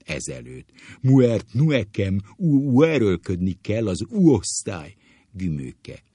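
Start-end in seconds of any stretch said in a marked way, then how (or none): 1.0-4.9 high, loud mock-teacher voice; booming teacher impression. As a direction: intone like a ponderous schoolmaster. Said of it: "ahogy a sárgaság tanár úr csinálta".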